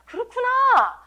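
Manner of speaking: high, drawn-out "oh, I see"; sudden realization. Acted. Preacher voicing a listener who finally gets it, sudden realization. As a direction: swoop up high as it finally clicks.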